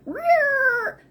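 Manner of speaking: a high, silly startled roar; cartoon freak-out noise; mock panic roar. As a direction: squeal it like a spooked mind. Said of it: "this dude's mind went".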